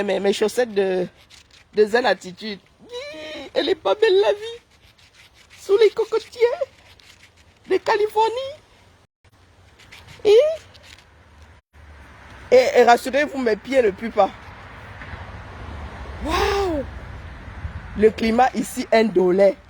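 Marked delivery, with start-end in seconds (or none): 2.9-10.3 high sing-song delight. Real, not acted. Voix aiguë chantante ravie. 16.2-16.8 high delighted wow. Real, not acted. Exclamation aiguë.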